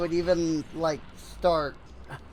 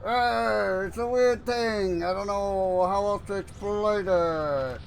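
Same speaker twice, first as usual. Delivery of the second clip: side-mouth voice; 3 semitones higher than usual. Shown as a demonstration. Explaining to viewers how the voice is made, in that side-mouth voice. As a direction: talk through the side of your mouth, pitched up. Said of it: "the side of my mouth".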